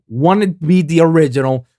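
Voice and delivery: usual speaking voice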